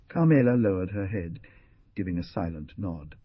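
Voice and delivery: plain reading voice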